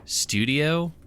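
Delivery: slow, high, showy announcement; grand reveal. Acted. Gregory proudly unveiling the studio to Josie, showy announce. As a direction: stretch it out like unveiling a prize.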